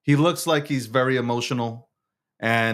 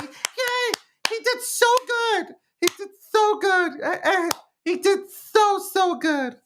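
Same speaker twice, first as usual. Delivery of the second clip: put-on very high, childish cheering voice; mocking Democrats; slower than usual. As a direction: gush like a giddy kid, pitched way up. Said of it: "Democrats go like".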